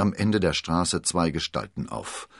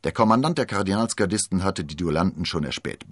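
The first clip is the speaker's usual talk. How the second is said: plain reading voice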